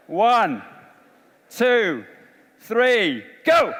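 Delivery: called out high and loud to the hall; rally the room with a high, loud count; loud countdown. Real, not acted. Counting down for the crowd.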